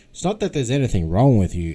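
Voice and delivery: ordinary talk, nothing marked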